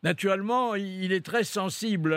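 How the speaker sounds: usual speaking voice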